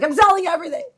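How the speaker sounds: high, loud panicked outburst. Retold or imitated. Retelling her market-crash panic, high loud outburst. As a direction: replay the panic of selling it all. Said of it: "and I was panicked".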